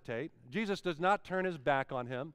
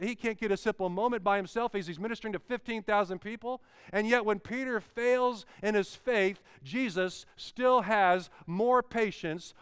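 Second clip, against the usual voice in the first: usual speaking voice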